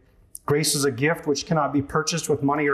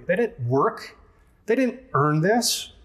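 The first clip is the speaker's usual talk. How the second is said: rising, slowing mock outrage on didn't; elder brother huffing that they didn't earn this; mock indignation; 5 semitones higher, slower than usual. Resentful elder brother, mock indignant rising pitch.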